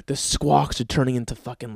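normal talking voice

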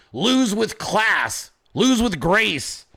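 slow, mocking recital of a slogan. Mocking the party slogan, slow and sneering. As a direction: drag it out like a sneer. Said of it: "Your entire slogan is".

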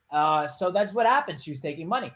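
ordinary talk, nothing marked

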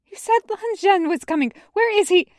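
high, shaking with terror; shaky terrified voice. Acted. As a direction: plead in sheer fright. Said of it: "He's terrified right down to his bones".

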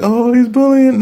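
high, loud mocking voice of critics; mocking people. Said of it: "People are like".

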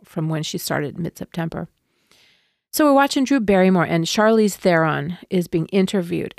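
ordinary delivery

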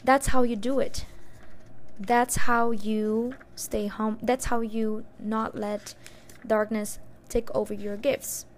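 voice of the universe. Retold or imitated. Repeating the universe's words to viewers, slow loud decree. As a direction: deliver it like a cosmic decree. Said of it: "say it the way that the universe told me".

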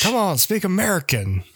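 high, cranky Southern codger; grumpy Southern old man. Pretend. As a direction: whine like a bigoted old grump. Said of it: "we always devolve into that guy".

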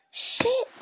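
high loud yelp; startled high curse; sudden curse. Real, not acted.

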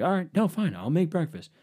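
high, sulky pouting whine; high-pitched bratty huff; huffy attitude. Retold or imitated. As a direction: sulk it out like a brat having a fit. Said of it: "I'm catching an attitude".

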